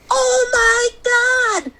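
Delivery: high, slow, drawn-out oh my God; shocked gasp. Retold or imitated. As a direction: sister recoiling at the bloody pillow. Sister discovers bloody pillow.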